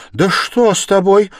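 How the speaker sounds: high, loud outcry of a worried mother; a sharp motherly exclamation; alarmed cry. A narrator's reading. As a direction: cry out, pitch leaping up. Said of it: "вскрикнула мать".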